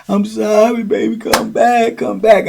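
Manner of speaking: high-pitched imitated begging; pleading. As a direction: beg a lover to return, acted high. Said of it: "he really pleading like".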